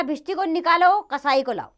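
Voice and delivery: loud, high-pitched order; royal command. Acted. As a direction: order your guards out at once. King orders his guards.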